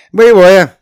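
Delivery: loud, high, bravado-filled reply; rousing battle-ready shout; gallant, spirited. A narrator's reading. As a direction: sing out like a bold trooper. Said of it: "браво откликнулся Бирский".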